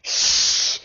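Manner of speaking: urgent hushing hiss; alarmed shush. Acted. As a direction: hush them quickly. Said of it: "said the squirrel in alarm".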